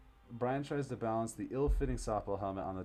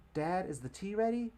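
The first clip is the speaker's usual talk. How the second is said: daughter's voice; 11 semitones higher, 3 dB louder than usual. Acted. Daughter asks dad.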